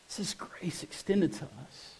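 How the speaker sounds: hushed, breathy whisper of awe. Acted. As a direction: drop to a whisper on grace. Hushed climax of sermon.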